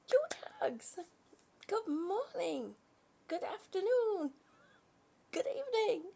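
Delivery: very high, squealy sweet greeting voice; sing-song greeting. Acted. Greeting a chat viewer Lee, high and gushing. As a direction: gush a hello up high.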